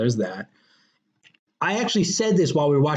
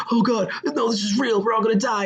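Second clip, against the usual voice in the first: mock panic; 7 semitones higher than usual. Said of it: "And he's like".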